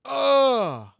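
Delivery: high, disgusted ugh then stop; a high grossed-out whine; disgusted groan. Retold or imitated. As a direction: recoil in mock disgust. Disgusted inner reaction, far voice.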